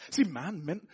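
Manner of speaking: ordinary delivery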